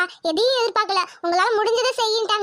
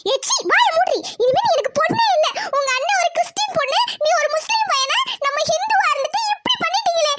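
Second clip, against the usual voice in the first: furious disowning; 5 semitones higher than usual. Acted. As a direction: tear into her, ending in wails. Mother disowns daughter, furious.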